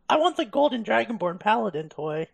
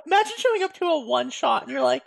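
usual speaking voice

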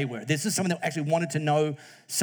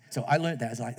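ordinary talk, nothing marked

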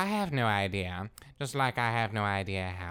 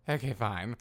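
ordinary talk

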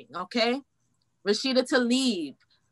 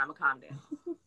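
softer and lower, settling down; deliberately calmed; 11 semitones lower, 8 dB quieter than usual. Real, not acted. Promising viewers to stop yelling, softer and lower. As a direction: bring the voice right down to calm.

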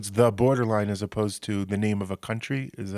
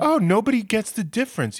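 much higher, delighted retelling of the electrician; imitating a delighted man; 10 semitones higher than usual. Host imitates the grateful Ukrainian electrician, much higher and delighted. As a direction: mimic the man's happy relief, pitched up. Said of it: "like, so happy".

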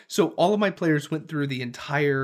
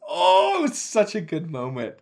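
high, stretched excited "oh"; thrilled recollection; 7 semitones higher, 4 dB louder than usual. Real, not acted. Dungeon master reliving the rescue, thrilled and high-pitched. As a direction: gush over a favorite memory.

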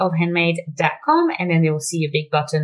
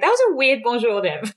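mock French listener; 6 semitones higher than usual. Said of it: "they would have been like".

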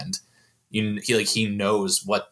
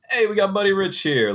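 cool guy teacher; 11 semitones higher, 4 dB louder, faster than usual. Retold or imitated. Mock cheerful teacher quote.

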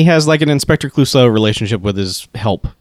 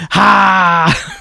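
higher, short "Ha!" as a battle cry; mock attack yell; 6 semitones higher, 3 dB louder than usual. Movie henchman announcing his attack, mock yell. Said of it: "come at him with yelling".

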